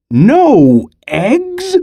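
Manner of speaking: very high rising cry; high shocked question. A narrator's reading. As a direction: Farmer Brown is stunned. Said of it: "cried Farmer Brown".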